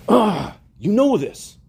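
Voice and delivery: groan, then protest as if worn down; exasperated. Retold or imitated. Peter pressed a third time by Christ, exasperated.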